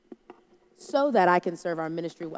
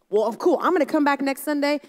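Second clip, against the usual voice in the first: louder, higher, cheerful promise; pumped visitor; 6 semitones higher, 6 dB louder than usual. Retold or imitated. Relieved visitor telling the pastor he'll come back, louder and pumped. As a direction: sound fired up to return. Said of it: "so pumped".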